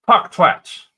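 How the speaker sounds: loud, heavy Swedish accent. Shown as a demonstration. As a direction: say Farquaad the Swedish way. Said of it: "It's just my Swedish accent".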